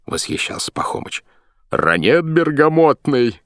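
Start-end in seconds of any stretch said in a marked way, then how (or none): 1.7-3.4 high, slow mumble through a gag; gagged. A narrator's reading. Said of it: "мычал через кляп боцман".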